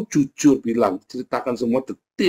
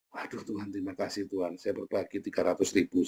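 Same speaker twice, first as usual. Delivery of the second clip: hushed, much softer thankful prayer; soft thanks; 7 semitones lower, 12 dB quieter than usual. Pretend. Giver quietly thanking God for his million. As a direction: murmur your thanks to God.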